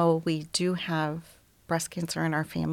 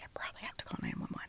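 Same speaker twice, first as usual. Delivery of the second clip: fast faint whisper; rushed whisper; 15 dB quieter, much faster than usual. Retold or imitated. Picking up the phone in panic, quick whisper. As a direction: rush it out under your breath.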